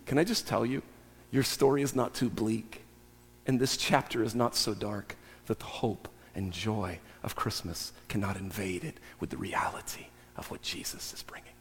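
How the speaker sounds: low, hushed voice fading towards a whisper; tender reassurance. Real, not acted. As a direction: reassure them softly, sinking almost to breath. Tender assurance closing the point.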